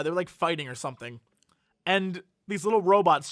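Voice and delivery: normal talking voice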